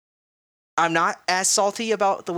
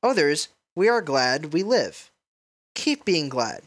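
poem reading; 4 semitones lower, much slower than usual. Acted. Reads a haiku.

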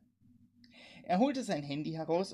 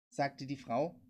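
ordinary delivery, nothing marked

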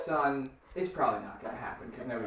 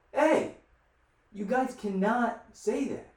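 put-on higher-pitched telling-off of kids; acted reprimand, pitched up; retold scolding; 9 semitones higher, slower than usual. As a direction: re-enact a stern grown-up warning.

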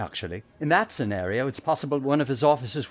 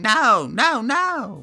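high, pleading no no no; imitating a voice; 9 semitones higher, 5 dB louder, much slower than usual. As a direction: mimic someone's desperate cry. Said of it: "It sounds just like he's saying".

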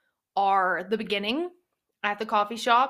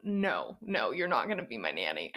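much lower mock man's voice, flatly turning her down; gruff refusal; 9 semitones lower, 4 dB quieter than usual. Reader voicing Cade refusing the nanny, deep and flat. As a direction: drop the voice to play the stern father saying no. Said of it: "he was like".

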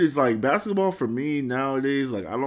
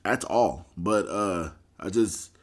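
ordinary talk, nothing marked

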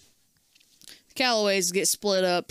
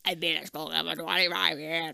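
imitation electrolarynx, pitched down; smoker's neck-device voice; 4 semitones lower, 4 dB quieter than usual. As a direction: mimic a longtime smoker talking through a throat device. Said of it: "He's got the fucking neck thing".

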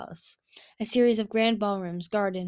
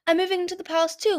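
high, fast, gushing; excited; 10 semitones higher, 6 dB louder, faster than usual. A narrator's reading. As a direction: rush the happy news out. Clearsight shares exciting news.

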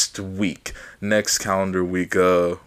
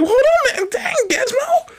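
startled high voice. Retold or imitated. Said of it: "I'm like".

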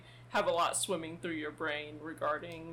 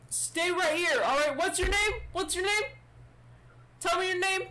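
high, loud, rattled interrogation; panicky guard questioning; 9 semitones higher, 4 dB louder than usual. Acted. Guard demanding a name from the stranger, high and frantic. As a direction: demand answers in a pitched-up official voice. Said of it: "He's like".

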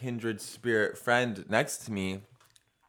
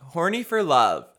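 announcer voice; 6 semitones higher, 7 dB louder than usual. Acted. Show title announce.

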